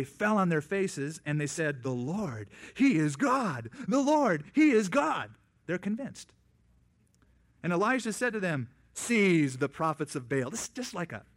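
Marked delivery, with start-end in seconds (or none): 1.8-5.3 loud, high cry building to a shout; crowd shouting. Retold or imitated. The people fall on their faces and cry out, shouting. 8.9-10.5 stern command. Retold or imitated. Elijah's command.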